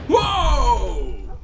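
loud sudden gasp of surprise; startled whoa. Real, not acted. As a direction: caught off guard, shout it.

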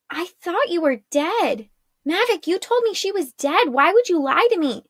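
high-pitched, disbelieving protest; shocked accusation. Acted. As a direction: demand an answer, hurt and stunned. Shocked girl accuses Mavic of lying.

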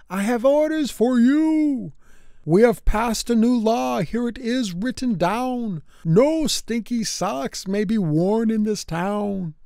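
a hint of a lawman announcing from afar; shouting sheriff. A narrator's reading. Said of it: "he shouted to Tim".

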